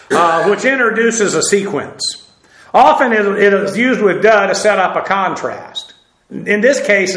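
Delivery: usual speaking voice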